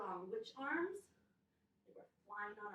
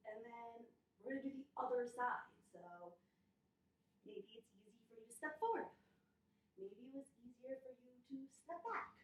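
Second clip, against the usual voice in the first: usual speaking voice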